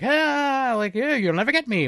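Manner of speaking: high, teasing villain voice; lightly hammed taunting invisible man; mock taunt. Invisible man teasing the police as he runs, high.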